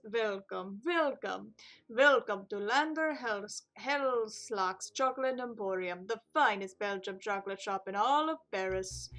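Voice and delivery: French chocolatier retrying his grand welcome; French accent. Acted. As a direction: savour each word like a French shop owner. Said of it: "This voice is weird".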